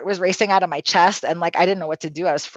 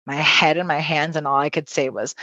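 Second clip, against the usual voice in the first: plain reading voice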